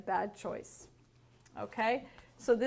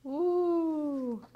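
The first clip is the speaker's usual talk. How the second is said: long, higher "ooh"; awed chorus of crocodiles; 5 semitones higher than usual. A narrator's reading. Little crocodiles thrilled by the Nile Queen, awed ooh. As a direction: hold the ooh in wonder. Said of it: "said all the little crocodiles".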